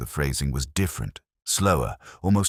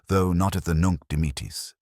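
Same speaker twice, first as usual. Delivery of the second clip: plain reading voice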